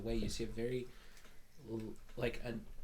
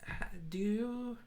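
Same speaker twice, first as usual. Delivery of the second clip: higher voice calling back through the curtain; calling to Song; 5 semitones higher than usual. Acted. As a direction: call sweetly to the back room. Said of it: "pull it and go".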